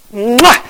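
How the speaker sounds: a smacking kiss sound. Real, not acted. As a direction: plant a big smack on him. Kisses the volunteer.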